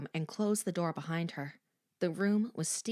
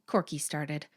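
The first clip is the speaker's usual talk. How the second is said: ordinary delivery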